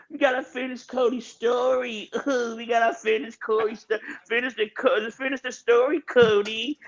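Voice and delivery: high-pitched mock pleading, half laughing; mocking the fans, high and pleading; mock whiny fans. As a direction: whine like begging fans. Mocking fans begging for Cody.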